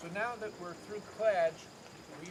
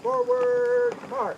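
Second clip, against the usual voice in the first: very loud, high held drill shout; drawn out parade commands; 12 dB louder, much slower than usual. Real, not acted. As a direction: hold "Forward" long and loud.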